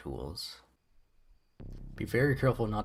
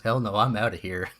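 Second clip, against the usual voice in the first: normal talking voice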